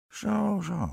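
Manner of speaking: high-pitched witch musing to herself; lightly voiced witch, thinking it over; muttering witch. A narrator's reading. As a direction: ponder aloud in a high witch voice. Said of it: "prevelde de heks".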